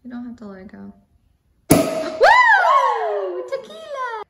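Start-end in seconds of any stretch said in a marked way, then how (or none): 1.7-4.2 excited whoop. Real, not acted. Popping champagne celebration.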